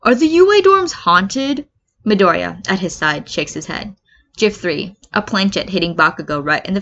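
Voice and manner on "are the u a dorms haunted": higher, slower nervous question; Kaminari voice. Acted. Kaminari asks Midoriya if the dorms are haunted, high and uneasy. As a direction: ask it warily, voice up high. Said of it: "Kaminari asks".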